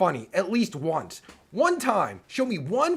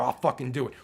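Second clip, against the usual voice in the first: normal talking voice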